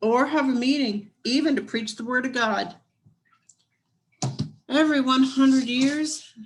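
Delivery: lively storybook reading voice; story narration. A narrator's reading. Reading a picture book aloud.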